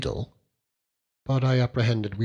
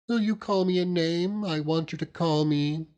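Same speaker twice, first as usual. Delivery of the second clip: put-on a touch higher, reproachful young wife; hurt little wife scolding her husband; lightly voiced young wife; 7 semitones higher than usual. A narrator's reading. Wife chiding her husband over the fish, lightly voiced.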